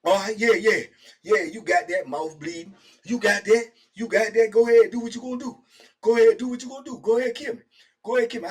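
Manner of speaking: high, loud go-ahead dare; retold defiance. Bleeding cellmate daring him, loud high dare. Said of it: "he like".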